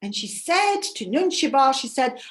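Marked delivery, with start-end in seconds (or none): none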